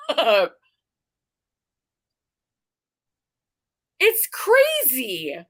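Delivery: high, wound-up disbelief; incredulous. Real, not acted. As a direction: pitch soaring in amazement. Stunned by insane story.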